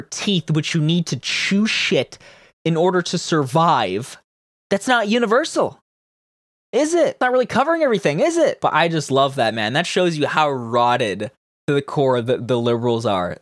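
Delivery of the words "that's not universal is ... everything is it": high, mocking rhetorical questions; sarcastic. Real, not acted. Podcaster ridiculing dental coverage, high and sarcastic. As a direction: needle the listener with a pitched-up is it.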